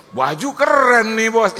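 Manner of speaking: loud, high, drawn-out compliment; hammed-up admiration, pitched high; mock-admiring shout. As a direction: call it out slowly at full voice. Acted loud compliment to becak driver.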